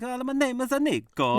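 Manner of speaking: high, hammed-up Italian accent; a jokey Italian accent, pitched high; mock Italian accent. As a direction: ham up a cheesy Italian accent. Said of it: "I like".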